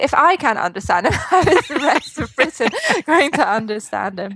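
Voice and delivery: amused, incredulous quote; laughing disbelief. Retold or imitated. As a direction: retell your bafflement through laughs. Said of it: "So I was like".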